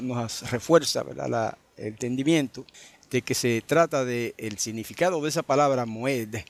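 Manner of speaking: normal talking voice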